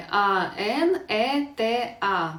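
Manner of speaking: «А Н Е Т А» повільно, з паузами; виразно розтягни кожну літеру; повільна диктовка. Shown as a demonstration. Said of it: "Дивіться". (вчителька пояснює, як скласти слово)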